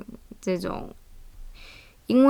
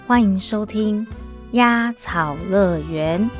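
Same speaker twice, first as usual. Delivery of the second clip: radio host intro. Acted. Podcast intro over music.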